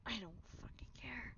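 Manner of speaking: real whisper, defiant. Real, not acted. Gamer shrugging off the game's guilt trip, whispered. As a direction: hiss it under the breath. Unvoiced after the scene.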